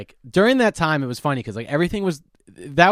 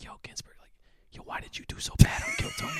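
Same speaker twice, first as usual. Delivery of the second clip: whispered funeral-goer's question; hushed whisper; 8 dB quieter than usual. Acted. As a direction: whisper it as a nosy mourner would. People approaching him at friend's funeral.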